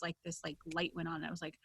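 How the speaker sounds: ordinary talk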